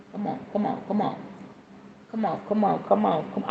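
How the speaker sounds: very soft, repeated urging; hushed urging. Real, not acted. Playful aside about loving sex, hushed urging. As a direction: say it under the voice.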